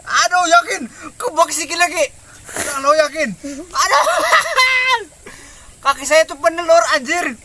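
high squeals between laughs; ticklish squealing. Real, not acted. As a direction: yelp and giggle, can't stand it. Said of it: "geli-geli gimana gitu". (menjerit geli sambil tertawa)

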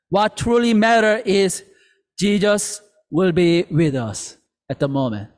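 usual speaking voice

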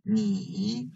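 你 said alone as a full dipping third tone. Shown as a demonstration. Teacher showing students 你 alone, a full dipping third tone. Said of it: "it is a third tone syllable".

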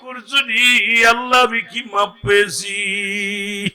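long, high melodic cry to God; tearful plea. Acted. Preacher acting the weeping man begging forgiveness, chanted tearful plea. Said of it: "খালি কান্দে আর বলে".

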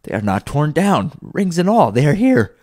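high, excited exclaiming; overjoyed relief. Acted. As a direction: marvel aloud, swelling with conviction. Scrooge overjoyed to be alive.

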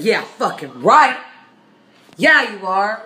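slow, loud sneering; sarcastic loud. Acted. Acted comeback to the driver, slow and sneering. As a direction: drag it out with scorn. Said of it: "I said".

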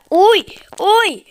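sharp high yelps of alarm; startled yelps. Real, not acted. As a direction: yelp as if just dodging a hit. Dodging hits in game.

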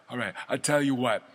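ordinary delivery

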